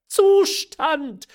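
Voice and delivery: very high, loud outraged exclamation; incredulous cry. A narrator's reading. Mother echoing her daughter's word, incredulous high cry. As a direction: shriek the word back in disbelief. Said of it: "rief die Mutter wieder".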